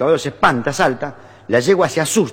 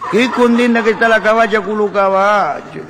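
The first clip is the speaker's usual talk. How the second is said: put-on high, drawn-out Italian-accented admiration; Italian immigrant; 9 semitones higher, 4 dB louder than usual. Italian gardener watching horses at the fiesta, Italian-accented marveling. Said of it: "el italiano lo mira y dice".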